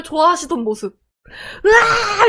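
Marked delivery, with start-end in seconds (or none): 1.6-2.3 high, loud whoop of joy; imitated victor's elated scream; delighted cry. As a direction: cry out in triumph. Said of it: "막 좋아하시던 모습을".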